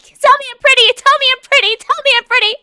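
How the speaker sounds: very high, loud, needy squeal; Trixie Tang. Acted. Streamer begs chat for compliments, high needy impression. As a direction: demand praise in a shrieky cartoon-girl voice. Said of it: "I'm like Trixie Tang".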